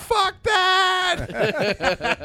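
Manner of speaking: high, loud panicked shout. Retold or imitated. Comic fleeing bouncer, high panicked shout. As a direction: yell it running away. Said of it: "I was like".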